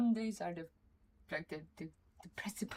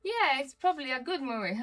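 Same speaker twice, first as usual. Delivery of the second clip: mock naive; 6 semitones higher, 7 dB louder than usual. Imagined naive moviegoer.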